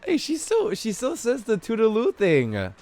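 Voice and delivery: ordinary talk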